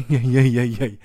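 loud string of protests; incredulous comeback; exasperated objection. Retold or imitated. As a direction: object loudly and repeatedly in disbelief. Comic retort to the shouted order.

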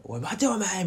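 high, half-voiced mock yell; retold urgent shout. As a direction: imitate a man yelling to stop someone. Man stopping a woman, retold.

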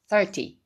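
thirty cut off crisply, no drawn-out vowel; thirty said quickly and chopped; clipped short thirty. Shown as a demonstration. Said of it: "これもパッと切る感じ".

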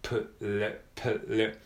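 "プル" said as a bare p-l cluster with no real vowel. Shown as a demonstration. Teacher drilling the opening pl of please. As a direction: join the p straight onto the l. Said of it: "子音が冒頭に二つ連続".

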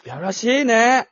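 mock lewd. Friends joking about creepy tippers, hammed-up slow leering tone. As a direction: savor the word like a sleazy tipper.

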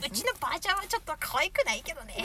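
ordinary talk

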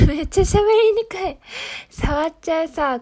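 laughing at her own mumbling. Real, not acted. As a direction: laugh through the words. Laughing at own numb speech.

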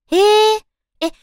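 high, loud impressed gasp. Real, not acted. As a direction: react with genuine wonder. Reacting to trivia. (感心した「へえ」)

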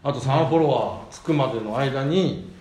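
normal talking voice

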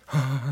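high breathy surprised gasp; startled gasp. Retold or imitated. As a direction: gasp like something startled you.

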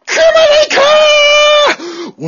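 a high, drawn-out anguished shout; impassioned scream. Acted. Music teacher screaming his band offer at the student. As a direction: cry it out with everything.

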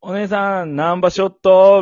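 loud, high Hakata-dialect pickup call. Retold or imitated. Fukuoka man hitting on a woman, exaggerated Hakata dialect. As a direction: lay on Hakata dialect, stretch the ending loudly. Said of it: "博多弁で行けや".

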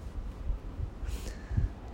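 quiet unvoiced chuckle; soft breathy laugh. Real, not acted. Laugh alone on line.

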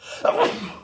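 a single sudden sneeze; sneezing. Real, not acted. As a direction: sneeze once, sharply. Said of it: "すいません、くしゃみ出ましたね".

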